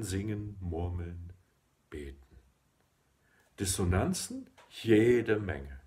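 measured, emphatic poetry reading with deliberate pauses; declaimed poem, pitch rising and falling with each phrase; poem recitation. Acted. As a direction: recite it line by line, lifting and stressing key words.

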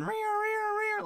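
high wailing air horn noise; air horn imitation. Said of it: "imagine with, uh, air horns playing".

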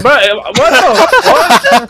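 startled shout. Real, not acted. Startled by game event.

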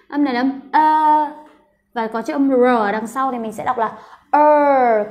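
ordinary delivery

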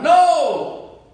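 high loud shouted no; cried back. Retold or imitated. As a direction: fling it back across the water. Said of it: "I cried out".

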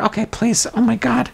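ordinary talk, nothing marked

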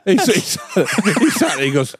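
usual speaking voice

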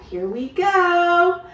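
loud, slow here we go; eager cue. Shown as a demonstration. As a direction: count them in with energy. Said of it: "a little bit faster".

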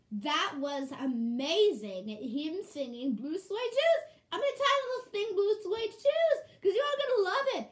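loud, climbing excited gush; gushing excitement. Acted. Announcing the video title after the song, gushing excitement. Gushing over the singing dog.

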